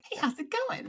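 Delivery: very high-pitched mock greeting; mock interviewer voice. Co-host teasing the host's small-talk questions, mock interviewer voice. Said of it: "she's like".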